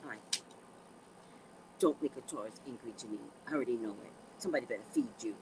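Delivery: private aside. Retold or imitated. Her private aside in story.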